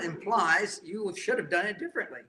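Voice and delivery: normal talking voice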